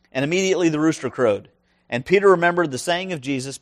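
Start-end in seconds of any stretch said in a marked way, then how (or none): none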